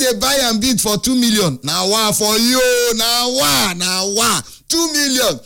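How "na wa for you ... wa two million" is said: slow, drawn-out na wa; slow amazed exasperation; exasperated disbelief. Real, not acted. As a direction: shake your head at the buyer, slowly. Exasperation at two million budget.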